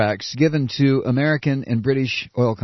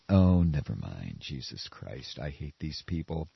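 much lower and softer muttered aside; disgusted mutter; 12 semitones lower, 9 dB quieter than usual. Real, not acted. Commentator disgusted with the newspaper, muttered. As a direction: give up in disgust under your breath. Exasperated aside about the Times.

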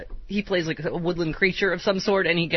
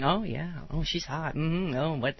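put-on leering old man; 4 semitones lower, 6 dB quieter than usual. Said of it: "I can hear him in my head doing".

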